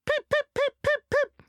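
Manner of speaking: very high, loud piping whistle; shrill-pitched pip pip whistle voiced high and loud; cheeky engine whistle. A narrator's reading. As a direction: toot it like a little engine whistle. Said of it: "he would whistle".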